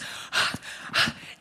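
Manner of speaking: a sharp unvoiced gasp of awe; excited gasp. [name] reaching Jane Goodall, breathless gasp. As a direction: gasp as you reach your hero. Rushing down hill to meet Jane.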